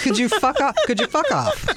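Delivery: high, laughing retort; laughing mock exasperation. Host retells snapping back at her teasing brother, laughing. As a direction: protest in mock outrage, pitch climbing. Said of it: "I'm like".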